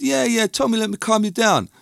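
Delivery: high-pitched placating quote; quoting himself, pitched well up; retold placating. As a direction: lift the voice to soothe someone upset. Retelling calming an upset woman.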